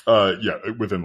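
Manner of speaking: ordinary talk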